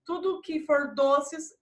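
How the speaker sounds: ordinary talk, nothing marked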